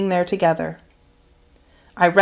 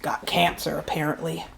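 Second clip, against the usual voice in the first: dropped voice; 2 semitones lower, 9 dB quieter than usual. A narrator's reading. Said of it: "his voice dropped down".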